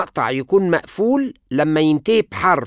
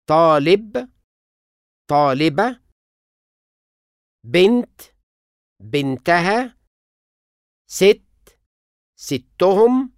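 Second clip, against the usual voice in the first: each word said apart, stress moving to the suffix; stress shift pairs; slower than usual. Shown as a demonstration. Teacher contrasting stress in word pairs. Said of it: "النبر بيتنقل".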